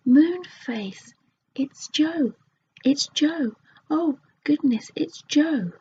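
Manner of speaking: high, loud excited cry; yelled out. Acted. Sisters recognising their brother Joe, yelling loudly. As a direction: shout the news with joy. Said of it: "yelled out loudly".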